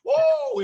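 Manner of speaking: high, loud victory whoop; celebratory whoop. Retold or imitated. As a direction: whoop like you just crushed it. Celebrating his sales success.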